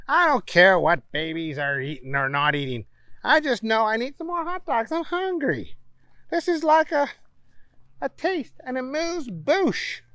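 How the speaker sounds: high, mocking boy voice; scoffing. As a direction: laugh off the idea. Booger insists he's hungry.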